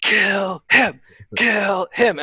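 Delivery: half-strength chant of a bloodthirsty audience; screaming audience. Acted. Game master voicing the screaming audience, mock yell. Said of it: "is screaming".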